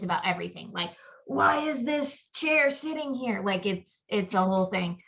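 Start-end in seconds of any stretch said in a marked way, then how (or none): 1.3-3.4 high, slow, fretful mock-worry; high, drawn-out anxious whine; anxious fretting. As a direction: play your own jittery self, slowly and high. Mocking her own anxious self.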